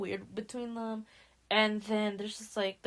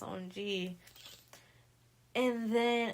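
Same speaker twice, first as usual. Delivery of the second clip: ordinary talk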